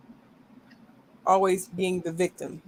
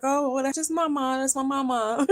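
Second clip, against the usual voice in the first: higher-pitched mock of an indulgent dad; lightly mocking, high-voiced doting father; 8 semitones higher than usual. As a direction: imitate a dad excusing his little girl, pitched up. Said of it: "he's like".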